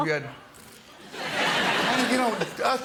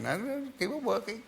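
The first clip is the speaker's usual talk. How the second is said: mother voice; 7 semitones higher, 5 dB quieter than usual. Retold or imitated.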